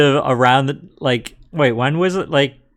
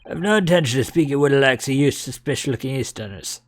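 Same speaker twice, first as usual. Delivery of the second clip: Scottish governor. Acted. Governor voiced in game.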